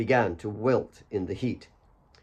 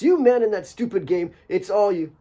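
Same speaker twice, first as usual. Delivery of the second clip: scolding; 9 semitones higher, 4 dB louder than usual. Acted. Mary scolding Alex.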